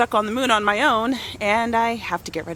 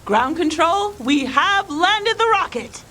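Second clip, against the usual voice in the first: high, loud mock mission call; play astronaut announcement; 10 semitones higher, 5 dB louder than usual. Astronaut radioing ground control in play, raised announcing voice. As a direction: announce it grandly, drawing out the landing. Mother playing astronaut with child.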